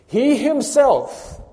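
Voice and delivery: He himself, high, loud and drawn out; emphatic HE HIMSELF. Shown as a demonstration. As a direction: proclaim "He himself" very slowly at full force. Preacher drives home the emphatic words.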